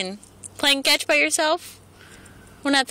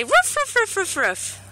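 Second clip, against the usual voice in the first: squeaky high puppy barks; dog bark imitation; 7 semitones higher than usual. Shown as a demonstration. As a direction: yip like a tiny puppy. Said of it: "Can you say".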